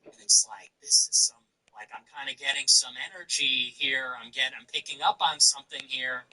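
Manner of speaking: ordinary talk, nothing marked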